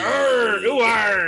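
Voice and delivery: pirate growl. Pretend. Pirate growl after "scary.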